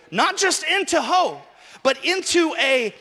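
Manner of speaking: loud, high, slow emphatic preaching; preaching shout. Acted. Pastor driving the point home to the church, shouting.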